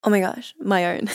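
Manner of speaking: ordinary talk, nothing marked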